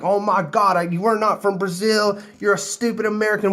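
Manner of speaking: high, mock yelling at a tourist; imitating angry girls shouting; retold yelling. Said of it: "these girls started just yelling".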